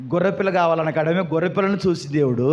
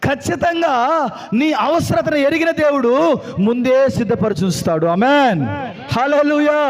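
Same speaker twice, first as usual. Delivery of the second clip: full-voiced proclamation with ringing amens; fervent preaching; 6 semitones higher than usual. Real, not acted. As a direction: rouse the congregation with each phrase. Preacher proclaims, calls hallelujah. (ఉత్సాహంగా, గట్టిగా ప్రకటిస్తూ)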